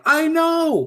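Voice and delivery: high, drawn-out exclamation; emphatic agreement. Retold or imitated. Agreeing with the imagined dog question, mock exasperated cry. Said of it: "And I'm like".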